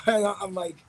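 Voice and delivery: ordinary delivery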